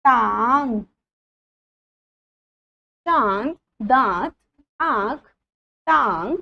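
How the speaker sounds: put-on careful teacher reading each word for children; slow word drill. Shown as a demonstration. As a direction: spell out every word clearly, one by one, for the class. Teacher reads word list aloud. (बच्चों को शब्द साफ़-साफ़ पढ़ाती हुई)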